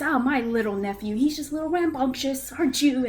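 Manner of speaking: fond uncle. Acted. Pip fussing over nephew.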